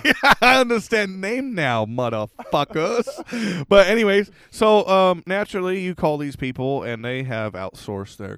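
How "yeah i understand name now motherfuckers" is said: laughing sarcasm. Real, not acted. Echoes joke while laughing.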